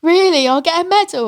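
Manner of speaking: high, loud, surprised cry; tearful disbelief. Retold or imitated. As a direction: gasp it out in teary amazement. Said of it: "I started crying, going".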